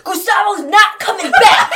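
drawn-out, high, full-volume shout; imitated yell. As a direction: shout it out slowly like an exasperated character. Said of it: "Kendall yells".

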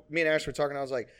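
ordinary talk, nothing marked

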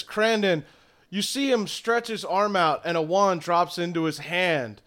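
ordinary delivery

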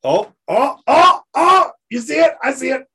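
high excited yelling; excited shout. Real, not acted. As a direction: shout it out in delight. Spotting the book on camera.

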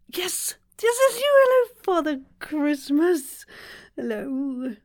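high, small starstruck voice; awestruck greeting. Pretend. Acted meeting with Father Christmas.

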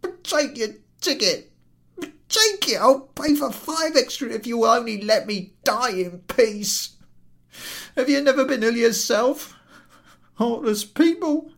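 high, loud, pained wail; woebegone invalid. Acted. Invalid to the ticket collector, woebegone and wailing. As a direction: moan like a sick man woken again.

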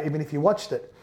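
ordinary delivery

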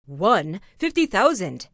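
loud, astonished outcry; a stunned mayor and council exclaim; astonished exclamation. Acted. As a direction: blurt out the huge sum. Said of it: "the exclamation of the astonished mayor".

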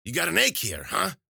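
loud, high crone voice; high, pushy witch doctor; prodding witch. Acted. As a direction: quiz the patient as an old witch. Witch examines the wounded patient.

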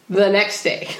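ordinary talk

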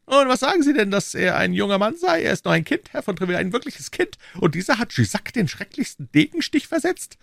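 high, lofty royal voice; a king speaking down to his subjects; king's voice. Acted. King to Treville, high lofty royal voice.

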